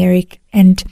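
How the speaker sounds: ordinary delivery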